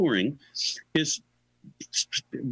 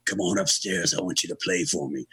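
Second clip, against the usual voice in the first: lightly imitated rock star, lower voice; 7 semitones lower than usual. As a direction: drop the pitch to summon him. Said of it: "he, he says".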